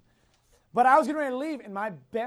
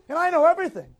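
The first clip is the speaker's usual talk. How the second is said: louder, higher smug boast; mock boast; 8 semitones higher, 11 dB louder, faster than usual. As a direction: brag with tongue in cheek. Joking self-boast.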